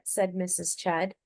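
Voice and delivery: usual speaking voice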